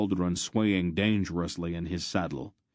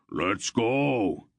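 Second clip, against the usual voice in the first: bellow; 4 semitones higher than usual. A narrator's reading. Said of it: "he bellowed".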